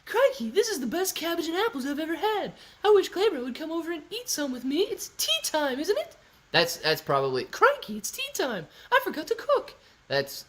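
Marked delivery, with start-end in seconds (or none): 0.0-6.1 high falsetto crikey impression with put-on accent; Lauren. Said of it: "Lauren does say crikey a lot". 7.4-9.7 very high falsetto impression; squeaky put-on accent, playing it for laughs; Lauren. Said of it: "my impression of both of those".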